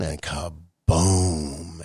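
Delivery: ordinary talk, nothing marked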